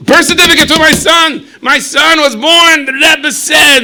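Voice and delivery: high, loud, overjoyed shout at the clerk; excited shout. Acted. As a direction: yell it through the window, bursting with joy. Berish bangs on clerk's window.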